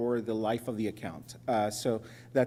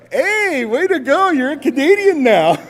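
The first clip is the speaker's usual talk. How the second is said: much higher and louder, cheering; cheering receptionist; 13 semitones higher, 13 dB louder than usual. Retold or imitated. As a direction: cheer him on. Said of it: "And she goes".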